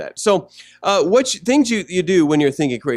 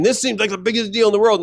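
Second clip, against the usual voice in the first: quick, raised-pitch exaggerated complaint; mock overreaction; 5 semitones higher, 4 dB louder, faster than usual. Pastor imitating a cranky person, worked-up overreaction. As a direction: hurry it out as if it were a crisis. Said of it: "in the process, you're saying".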